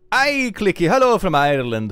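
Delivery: loud, put-on Irish accent; hearty mock Irish greeting, loud. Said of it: "do an Irish accent for this".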